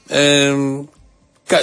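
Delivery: ordinary talk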